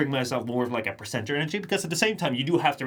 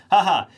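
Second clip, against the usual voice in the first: mock flat laugh; 4 semitones higher, 9 dB louder than usual. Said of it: "I'm not gonna be like".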